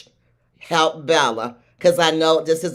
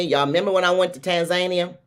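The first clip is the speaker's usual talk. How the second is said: usual speaking voice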